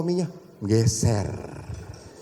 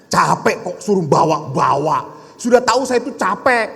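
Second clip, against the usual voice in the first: louder, peeved grumble, fast at the end; irritated husband complaint; 3 semitones higher, 6 dB louder than usual. Acted. Tired husband snapping at his wife for asking him to bring gifts. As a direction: snap back that you're worn out.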